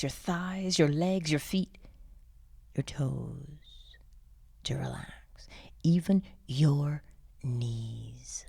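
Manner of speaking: normal talking voice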